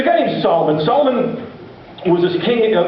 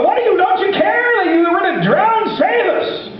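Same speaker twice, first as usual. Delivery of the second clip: panicked shout; 11 semitones higher than usual. Retold or imitated. Disciples in the storm yelling at sleeping Jesus in panic. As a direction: scream at him to wake up and save them.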